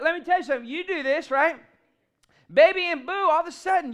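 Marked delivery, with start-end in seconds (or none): 2.5-3.1 put-on loud, high "Baby and boo"; showing how tone changes meaning; inflection demo. Shown as a demonstration. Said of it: "you can give a certain inflection".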